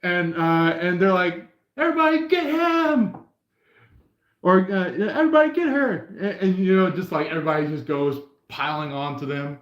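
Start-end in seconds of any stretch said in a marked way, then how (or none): 1.8-3.2 high, stretched rallying cry; rally the crowd, voice pitched way up; calling out. Acted. Bystander sends everybody after him, high mock rallying cry. 4.9-6.2 high rallying cry with a laugh; playfully rally the crowd, pitched up; calling out. Acted. Bystander sends everybody after her, high laughing call.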